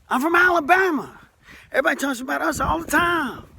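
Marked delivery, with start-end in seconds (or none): 0.1-1.2 "Alabama" called out much higher and louder; "Alabama" hollered high with a falling finish; proud shout. Shown as a demonstration. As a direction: belt out "Alabama" like a proud cheer.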